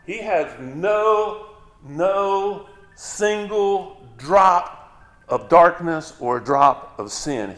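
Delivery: slow, pleading stress on "single drop"; emphatic no. Shown as a demonstration. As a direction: drag out "no single drop" for weight. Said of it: "the same thing with God".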